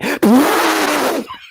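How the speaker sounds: high, tight blast through the lips; mock a beginner blasting it like a trumpet; tight trumpet buzz. Shown as a demonstration. Showing the main beginner mistake, high tight lip blast. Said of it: "They try and play it tight, like it is a trumpet".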